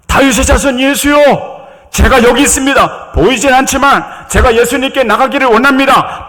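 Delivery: high, confident calling voice; bold crying out. Acted. Blind Bartimaeus calling to Jesus despite scolding, boldly crying out. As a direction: call out boldly, pitch held high. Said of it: "당당하게 소리 지르며".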